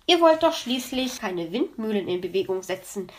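ordinary delivery, nothing marked

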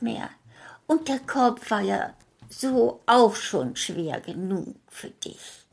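put-on hushed, whispery old woman; old grandmother.

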